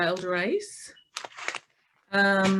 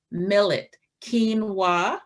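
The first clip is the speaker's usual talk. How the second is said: ordinary delivery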